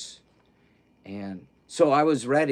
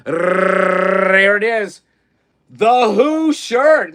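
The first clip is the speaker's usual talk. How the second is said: much louder, higher and slow, drawn-out announcement; grand reveal; 8 semitones higher, 11 dB louder, much slower than usual. Real, not acted. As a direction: present it like a showman's big unveiling. Drum roll reveal of shirt.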